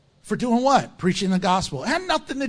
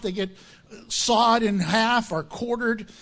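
ordinary delivery

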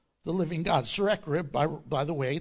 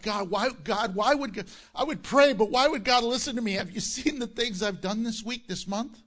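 louder, higher, rushing self-doubt; doubting believer; 4 semitones higher, 4 dB louder than usual. Retold or imitated. Pastor voicing a guilty believer asking why God would listen, pleading. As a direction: plead as someone who feels unworthy. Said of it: "Have you ever felt like".

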